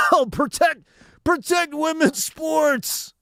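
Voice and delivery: slow, a hint of a sarcastic mocking voice; mocking quote. Mocking people who claim to care, slow sarcastic voice. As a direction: lightly parody the outraged crowd. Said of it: "trying to claim, like, you know".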